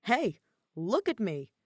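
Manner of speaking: loud, slow call for attention; a slow loud mock yell; mock scream. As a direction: shout it out slowly like a flashy sign. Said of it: "practically scream".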